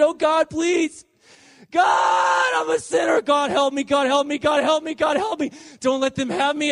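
high, urgent cry for help; yelled desperate plea. Retold or imitated. Tormented sinner begs God for help in the storm, desperate yelling. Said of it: "I was yelling, I was cursing God. And then I said".

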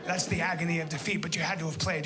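ordinary talk

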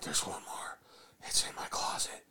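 put-on scared kid's whisper. Boy whispering to his dad about the closet monster. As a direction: breathe it like a frightened little boy.

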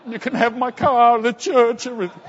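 high, loud, laughing overblown offer; laughing exaggeration. To the pastor, laughing while offering him everything. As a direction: laugh as you throw in everything you own. Overwhelmed giving everything away.

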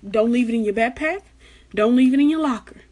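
usual speaking voice

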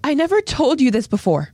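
high, earnest confession; Historia. Acted. Historia confiding in Ymir as she listens carefully, high and earnest. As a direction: lift the voice high for a heartfelt declaration. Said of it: "listens very carefully".

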